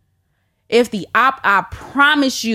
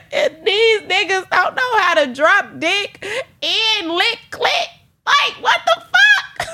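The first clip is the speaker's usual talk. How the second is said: very high, tearful wail breaking into laughter; squeaky, laughing sob-talk; desperate meltdown; 14 semitones higher than usual. Acted. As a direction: whine like an addict in withdrawal. Comic withdrawal meltdown, laughing and wailing.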